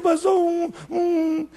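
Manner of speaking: acted catch of a sob; voice breaking. Preacher's sinner chokes up, mock tearful. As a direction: falter as if about to cry.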